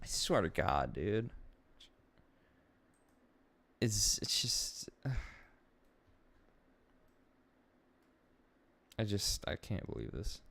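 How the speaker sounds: very quiet, low and halting; hushed, lost-for-words disbelief; stunned, upset. Real, not acted. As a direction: barely get it out, with long pauses. Stunned, upset, long silences.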